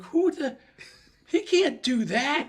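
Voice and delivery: high, loud, slow indignant disbelief; he can't do that. Retold or imitated. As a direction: sputter out in disbelief. Outraged at rule breaker.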